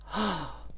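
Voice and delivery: a quick sharp gasp of mock shock. Recapping the show to viewers, a mock shocked gasp. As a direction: gasp as if the twist just hit you. Reacting to the story twist.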